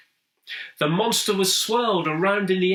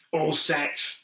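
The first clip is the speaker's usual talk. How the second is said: plain reading voice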